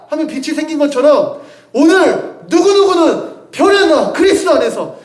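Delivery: ordinary talk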